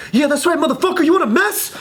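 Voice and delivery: high mock street-tough taunt; girlfriend turned tough. Girlfriend from the back seat, tough taunting. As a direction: act out a girlfriend egging on a fight. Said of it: "I've never heard her talk like this".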